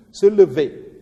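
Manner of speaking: "se lever" with a loud, high final -ver; accent on VER. Shown as a demonstration. Teacher marking the tonic accent, strong stress on the end of "se lever". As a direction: punch the last syllable of "lever". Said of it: "Sur le V, tu mets un accent".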